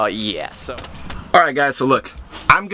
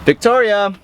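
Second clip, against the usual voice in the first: raised voice calling a name; 9 semitones higher than usual. Real, not acted. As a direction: call her from across the house. Calling sister from another room.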